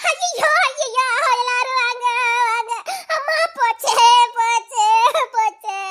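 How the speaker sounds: despairing cries that fade down; wailing lament. Acted. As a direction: wail for help, losing strength. Laments, calling for mother.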